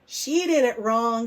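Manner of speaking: put-on loud, slow, teasing kid; mocking classmate. Andrew jeering at Sophie's blue tree, loud and slow. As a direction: jeer it at her across the table.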